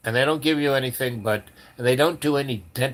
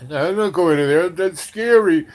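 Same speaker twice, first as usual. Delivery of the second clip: higher and louder, a hammed-up frightened protest; mock scared whine; 6 semitones higher, 7 dB louder than usual. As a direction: whine like a chicken who won't go in. Timid reporter refuses to enter bar.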